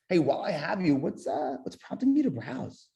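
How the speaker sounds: natural hesitant "what's, uh"; little stutter. Shown as a demonstration. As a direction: add a small natural stumble. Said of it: "I do a little stutter in there".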